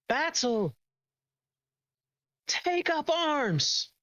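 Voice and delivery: high, slow rallying call; warning voices in a dream, high, slow rallying call; mock battle cry. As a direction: call everyone to arms. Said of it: "And they're, like, screaming".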